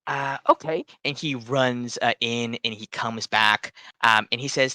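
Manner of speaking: normal talking voice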